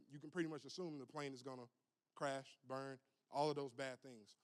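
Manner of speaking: usual speaking voice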